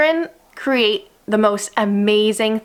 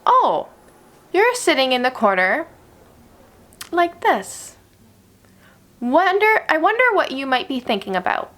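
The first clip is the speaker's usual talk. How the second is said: gentle curious teacher; 4 semitones higher than usual. Shown as a demonstration. Teacher kneeling by a sulking child, high gentle wondering. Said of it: "Instead, you're gonna wanna try to say".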